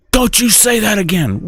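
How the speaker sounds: high-pitched mock warning; scolding wife. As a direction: imitate a wife telling him off. Acted wife scolding husband.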